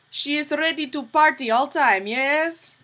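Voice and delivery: high, loud mock Russian accent.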